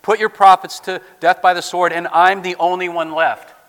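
loud, impassioned Elijah complaint; forceful lament. Acted. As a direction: pour out the grievance at full voice.

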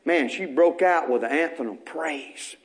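normal talking voice

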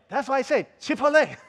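high, loud mock-indignant parent; upset retort. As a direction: fire back like an exasperated parent. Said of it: "they get upset at you".

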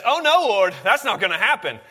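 high, loud protest as Peter. Retold or imitated. Preacher voicing Peter to congregation, loud alarmed protest. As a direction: Peter pleading with Jesus, rising up loud. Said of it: "Peter says".

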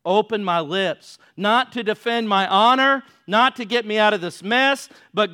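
loud, pressing plea; fervent preaching. Acted. Preacher crying out the psalm's plea, loud and urgent. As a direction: push each phrase harder.

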